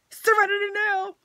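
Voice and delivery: high strained yell of a mantra; shouted mantra. Real, not acted. Yelling a calming mantra at herself, strained and high. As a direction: yell the calm words, clearly not calm.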